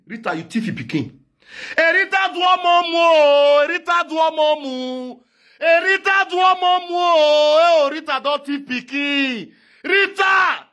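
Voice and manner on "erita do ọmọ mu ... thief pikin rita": high, loud singsong cry; wailing accusation. Acted. Neighbour accusing Rita of stealing the child, wailing high and loud. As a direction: wail it out like a market accusation.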